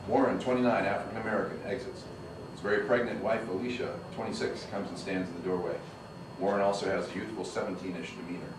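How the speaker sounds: ordinary delivery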